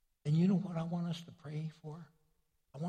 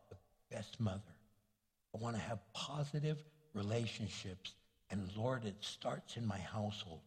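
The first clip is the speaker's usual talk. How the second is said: prayer; 6 semitones lower, 6 dB quieter than usual. Acted. Pastor leading congregation in prayer, soft low. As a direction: pray humbly and softly.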